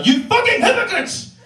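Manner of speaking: shrieked high, furious yell; sudden high-pitched rant; angry outburst. Acted. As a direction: hurl it at them in outrage.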